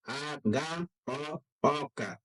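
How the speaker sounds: A-ga-o-oka stretched slowly, each syllable pulled apart. Shown as a demonstration. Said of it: "syllable by syllable teaching".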